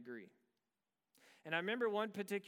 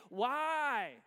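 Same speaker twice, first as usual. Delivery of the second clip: loud, higher cry of why; anguished cry; 7 semitones higher, 7 dB louder than usual. Retold or imitated. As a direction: let the why break out as a plea. Climax of retold prayer.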